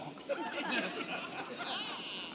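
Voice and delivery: unvoiced airy laugh; breathless laugh. Real, not acted. Pastor laughing at his own harsh put-down, breathy. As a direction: laugh at the joke without voice.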